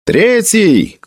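high-pitched call to the ranks; shouted command. A narrator's reading. Felix calls to the Third battalion, shouting. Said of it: "крикнул Феликс".

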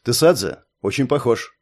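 ordinary delivery

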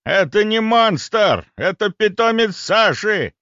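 high, slow, loud bellow; рёв. A narrator's reading. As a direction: roar each word to get through to her. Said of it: "взревел он ей в лицо". (гневно ревёт, растягивая слова)

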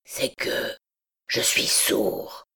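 low, slow, half whispered confession; halting, ashamed admission; Quasimodo, hesitant. A narrator's reading. Hunchback confessing his deafness to the girl, low and halting. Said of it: "comme hésitant à achever".

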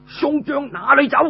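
high, showy battle cry; put-on shout. General fakes a challenge in a high battle cry. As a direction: shout it out for show. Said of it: "裝腔作勢咁向後面大叫".

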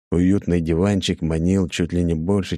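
ordinary delivery, nothing marked

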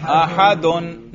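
"احدن" slowly, ending in a clear -dun; رفع کا تلفظ. Shown as a demonstration. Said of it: "اس کے رفع پر ہم تلفظ کر رہے ہیں". ("احدن" کے آخر میں دو پیش، یعنی اَحَدُن)

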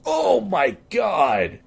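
high, drawn-out shocked exclamation; shocked outcry. Real, not acted. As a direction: cry out slowly at what you see. Reacting to shocking crash clip.

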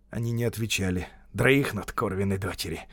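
usual speaking voice

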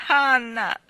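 put-on loud, high cry of an upset child; sobbed cry. A narrator's reading. Davy throwing his arms round Anna, sobbed cry. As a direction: cry the name out, acting a sob. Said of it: "singhiozzò Davy buttandole le braccia al collo".

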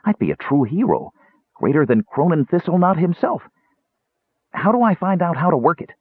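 high, excited kender voice; eager, high-pitched wonder; thrilled kender. Acted. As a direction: let the pitch climb with hero dreams.

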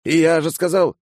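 high indignant protest; mildly heated young count; flaring up. A narrator's reading. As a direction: protest at having to repeat yourself. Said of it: "вспылил граф".

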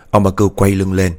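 ordinary delivery, nothing marked

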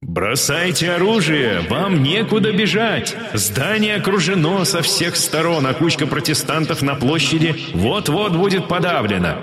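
slow, high megaphone ultimatum; loudspeaker voice. Acted. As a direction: announce it over a loudspeaker. Enemy announcer ultimatum through loudspeaker.

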